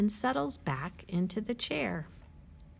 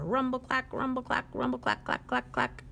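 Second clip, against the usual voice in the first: train rhythm; 5 semitones higher than usual. Acted. Imitating freight train rhythm.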